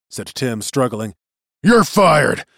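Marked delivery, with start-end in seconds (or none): 1.6-2.4 loud, raised sacking; captain shouting a crewman off the job; angry outburst. A narrator's reading. Furious captain sacks crewman.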